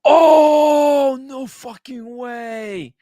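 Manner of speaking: loud high gasp then slow drawn words; stunned, drag out the no way; shocked disbelief. Real, not acted. Sees wife's wrist watch.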